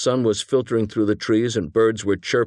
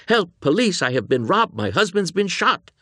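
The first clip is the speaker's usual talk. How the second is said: high-pitched, put-on cry for help; hammed-up damsel in distress, well above usual pitch; mock distressed wife; 10 semitones higher than usual. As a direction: imitate a wife faking alarm. Acting out wife's fake cry for help.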